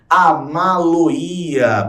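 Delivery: Amaloia drawn out slowly, syllable by syllable; slow teaching demo. Shown as a demonstration. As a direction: spell out Amaloia slowly so each part is clear. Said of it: "Perceba, o pronome está no meio do verbo".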